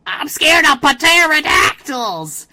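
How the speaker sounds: high, loud, slow wail; mock Sheen shriek. Imitating Sheen being carried off, high loud wail. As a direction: howl it like a terrified cartoon kid. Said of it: "He's like".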